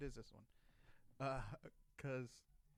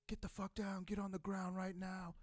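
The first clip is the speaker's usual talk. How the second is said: higher, louder barked command, retold; imitated guard's shout; 6 semitones higher, 5 dB louder than usual. Retold security guard yelling at the Marines to get down, a loud barked order. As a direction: bark it like a guard with his gun drawn.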